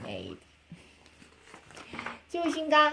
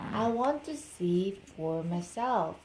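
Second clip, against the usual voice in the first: ordinary delivery, nothing marked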